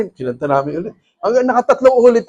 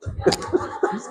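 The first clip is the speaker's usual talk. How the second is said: breathy laugh; 9 semitones higher, 11 dB quieter than usual. Real, not acted. Laughs at the news.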